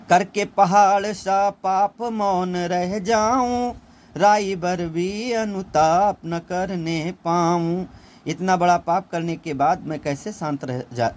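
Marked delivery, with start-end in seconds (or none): 0.1-7.8 slow, drawn-out recital of the verse; Kaikeyi's plea to Ram recited slowly as verse; poem recitation. Acted. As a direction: read it like a poem, each word stretched.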